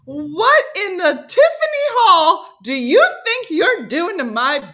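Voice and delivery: slow, high, emphatic exclamation; mock outrage. Playing the squeezed wife with new catchphrase, mock outrage. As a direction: stretch it out in hammed-up indignation.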